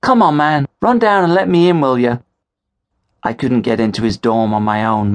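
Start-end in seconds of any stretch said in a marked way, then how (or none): 0.0-2.2 loud, high plea to be let in; impatient call up to a friend. Acted. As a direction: shout through the rain. Calling up to locked dorm.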